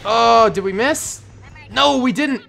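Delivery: high, thrilled disbelief; gleeful surprise; excited. Real, not acted. Surprised cheer at bomber hit.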